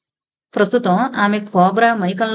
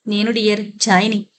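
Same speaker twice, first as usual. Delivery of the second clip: usual speaking voice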